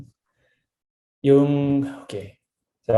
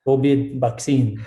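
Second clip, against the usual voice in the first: slow Filipino-accented "kobid baksin". Shown as a demonstration. Guest answering a challenge to pronounce it, Filipino accent. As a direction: put on a Filipino accent, v turned to b. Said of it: "With a Filipino accent?".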